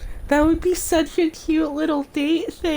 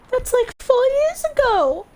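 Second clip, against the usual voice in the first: shocked exclamation; 13 semitones higher, 5 dB louder than usual. Acted. Character shocked at four years.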